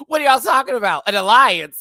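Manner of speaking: high, booming nosy question; mock-clueless, far too loud; loud [name]. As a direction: blurt it out for all to hear. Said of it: "Girl, I know you were loud".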